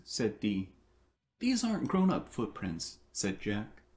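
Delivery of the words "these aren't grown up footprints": put-on child voice. A narrator's reading. Jack voiced in storybook.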